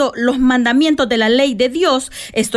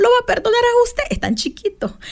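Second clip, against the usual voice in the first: put-on tender to child; 9 semitones higher than usual. Mother tells her little son he may eat the apple, tenderly.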